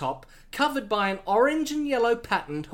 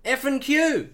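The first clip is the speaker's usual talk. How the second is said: higher, louder proud Aussie; Australian accent; 5 semitones higher, 5 dB louder than usual. Acted. Steve-O boasting to Bond about home, Australian accent. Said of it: "Australian accent".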